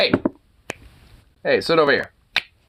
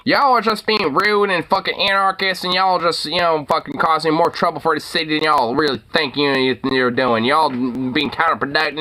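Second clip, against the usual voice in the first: higher, lightly voiced Southern-tinged news anchor scolding protesters; Silicon Valley Southern accent; 5 semitones higher than usual. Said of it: "Silicon Valley Southern accent mixture".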